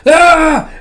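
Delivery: long, high, loud "aaah" scream; electrocuted scream. Acted. Scientist gets electrocuted, screaming. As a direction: scream out a sustained high shriek.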